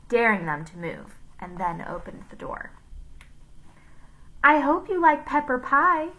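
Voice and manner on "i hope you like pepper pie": higher, sugary threat; sweetly before the trick; mock sweet lunch lady. A narrator's reading. Miss Mush about to smash the pie, mock sweet. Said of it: "said Miss Mush".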